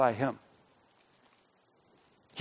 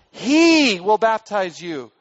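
"he" weighted, the phrase slowed; stress on he; 4 semitones higher, 5 dB louder, slower than usual. Shown as a demonstration. Preacher quoting John to the crowds, slow stress on "he". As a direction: draw out the line, pressing on "he". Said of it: "the emphasis is upon he".

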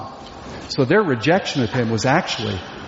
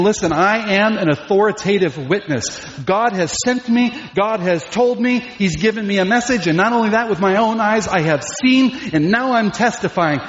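higher, emphatic testimony; speaking with authority; 6 semitones higher than usual. Acted. John testifies to the people about Jesus, authoritative and insistent. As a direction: declare it as an eyewitness who must be heard. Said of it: "I'm speaking to you with authority".

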